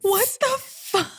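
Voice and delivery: high, long drawn-out disbelief; flabbergasted. Real, not acted. Listener floored by the Four Seasons outing, flabbergasted. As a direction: stretch the last word out in amazement.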